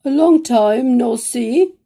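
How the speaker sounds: shy hare stumbles into hello; hesitant hare. Acted. Hare voiced greeting.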